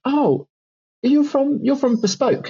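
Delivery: high, chirpy imitation of a surprised publisher rep; mock surprised publisher. Imitating the publisher who recognises him, high and chirpy. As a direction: play the eager rep, pitched well up. Publisher staff voiced in anecdote.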